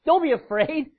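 angel reassuring. Retold or imitated.

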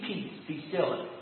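soft, slow and low peace be still; an unhurried hushed command to the storm; calm command. Shown as a demonstration. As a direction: speak it quietly with total authority. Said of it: "all Jesus had to do was stand up and speak".

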